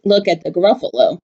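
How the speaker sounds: ordinary delivery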